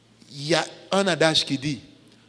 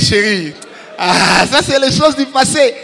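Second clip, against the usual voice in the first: laughing shout, much louder; 5 semitones higher, 9 dB louder than usual. Retold or imitated. Brushing off his wife's question, laughing and loud. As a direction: laugh it off loudly.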